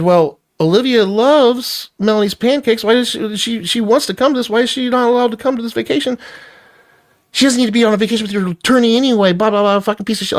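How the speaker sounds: high, rushing, exasperated argument; heated retort. Retold or imitated. Arguing with his ex over the vacation, high and rushed.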